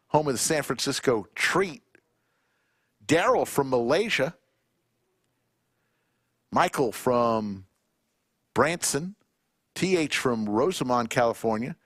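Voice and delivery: ordinary talk, nothing marked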